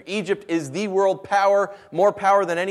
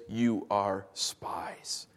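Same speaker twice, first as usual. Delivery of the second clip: menacing whisper; 7 semitones lower, 8 dB quieter, much slower than usual. Acted. Joseph's final accusation.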